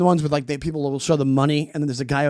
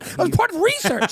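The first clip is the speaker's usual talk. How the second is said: mocking; 13 semitones higher than usual. High mocking voice.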